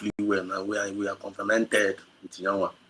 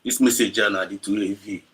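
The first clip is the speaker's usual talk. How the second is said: emotional, near tears. Real, not acted. Speaker overwhelmed by the message, voice breaking and fading. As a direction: struggle to hold back tears.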